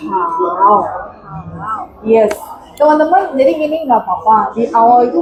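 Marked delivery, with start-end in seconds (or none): 0.1-0.8 Hao said low and falling; third tone model. Shown as a demonstration. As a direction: dip Hao lower. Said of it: "lebih turun lagi". (Hao nada tiga lebih turun)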